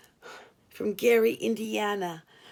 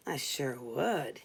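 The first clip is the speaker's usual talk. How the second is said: low declaring voice; 9 semitones lower, 4 dB quieter, slower than usual. A narrator's reading. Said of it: "he declared".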